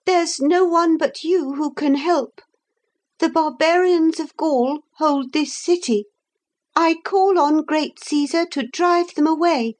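put-on high-pitched boy appealing for help; slightly trembling plea. A narrator's reading. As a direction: implore a great commander, pitched very high. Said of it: "in a voice that trembled a little".

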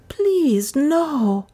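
high-pitched pitiful plea; pitiful begging. A narrator's reading. Butler cringes from his accuser, begging pitifully. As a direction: beg in a high, drawn-out voice. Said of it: "he begged pitifully".

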